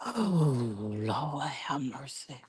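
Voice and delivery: fading to a whispered plea; exasperated sigh. Retold or imitated. Pete exasperated at Tim, fading whisper. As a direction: let it die into a whisper. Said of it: "He was just like".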